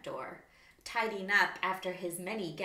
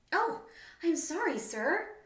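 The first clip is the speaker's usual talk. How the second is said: high, squeaky little voice; a small wallaby squeaking an apology; 10 semitones higher, 5 dB louder than usual. Acted. Wallaby squeaks sorry to the wombat. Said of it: "squeaked the wallaby".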